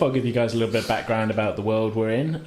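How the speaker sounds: normal talking voice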